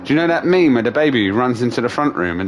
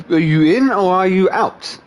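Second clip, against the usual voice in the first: frustrated; 10 semitones higher, 5 dB louder than usual. Retold or imitated. Frustrated at cats.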